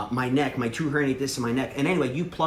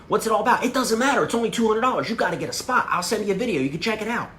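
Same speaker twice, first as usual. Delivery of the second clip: higher and louder, eager; hyped pitch; 8 semitones higher, 3 dB louder than usual. Shown as a demonstration. Pitching gel to drinking buddies, excited. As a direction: sell it like a buddy. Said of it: "you gotta be like".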